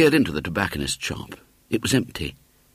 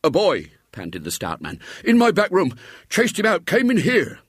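breathless stout man; 10 semitones higher than usual. A narrator's reading.

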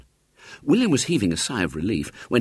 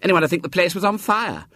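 higher, indignant demand; 11 semitones higher than usual. A narrator's reading. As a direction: sound put out at the fuss. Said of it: "he said indignantly".

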